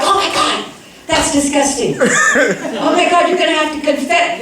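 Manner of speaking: high, laughing mock-disgusted sister; mocking older sister. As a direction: squeal it like a scandalized big sister. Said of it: "my older sister walks in and is like".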